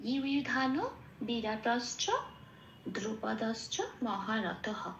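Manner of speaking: slow, careful recitation with pauses. Shown as a demonstration. Teacher reciting the whole second line. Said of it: "পুরো লাইনটা কি পেলাম?".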